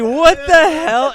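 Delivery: high, loud, slow disbelief; shocked. Retold or imitated. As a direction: draw out the shock. Accountant reacting to Instagram.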